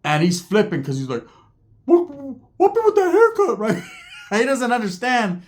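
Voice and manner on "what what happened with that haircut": very high, squeaky mock voice; high cartoon voice imitation; Ricky's voice. Ricky flipping out.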